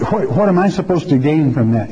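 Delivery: ordinary talk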